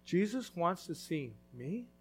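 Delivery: soft, slow, wondering question; amazed disbelief. Acted. Zacchaeus marvels that Jesus wants him, softly amazed. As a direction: marvel that he was chosen.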